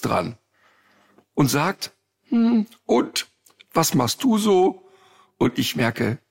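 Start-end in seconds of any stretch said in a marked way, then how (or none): 2.3-4.9 panicky friend. Retold or imitated.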